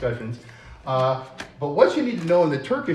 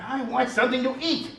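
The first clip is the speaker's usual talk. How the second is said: 1 puppet voice; 11 semitones higher, 7 dB louder, faster than usual. Acted. Puppet hungry.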